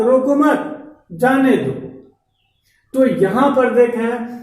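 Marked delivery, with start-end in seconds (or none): 0.0-1.7 "रोको मत" joined, break, then "जाने दो"; higher रोको मत, pause, falling जाने दो; group मत with रोको, then जाने दो apart; pause after मत. Shown as a demonstration. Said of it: "लेकिन वहीं दूसरा वाक्य".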